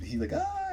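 a high strangled zombie ah; zombie groan. Retold or imitated. Zombie at the gate, a high strangled ah. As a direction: mimic the dying zombie's cry.

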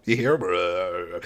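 slow lingering mock question; drawn-out repeat. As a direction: draw it out, dragging each word. Mock survivor pointing at zombie.

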